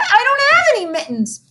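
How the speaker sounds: wailing. Acted. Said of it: "wailed Victoria".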